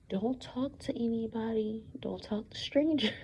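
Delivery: mother's quiet warning, dropping to a whisper on the second line; hushed warning. Retold or imitated. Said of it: "and she said".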